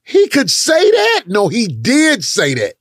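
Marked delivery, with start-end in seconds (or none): 0.0-1.2 high, slow stunned disbelief; shocked disbelief. Retold or imitated. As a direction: squeal it like you can't believe your ears. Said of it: "You gonna be like".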